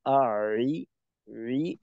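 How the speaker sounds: রি held at its throat point; corrected ain. Shown as a demonstration. Teacher models the fixed রি for the student. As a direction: keep the ain in place while saying রি. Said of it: "রি বলার সময় আরেকটু মাখরাজে রাখতে পারলে".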